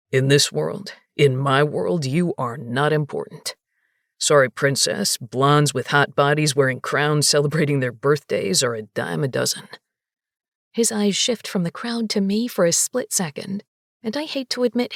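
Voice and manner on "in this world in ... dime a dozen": low, cold bouncer lecture. A narrator's reading. Narrator voices bouncer.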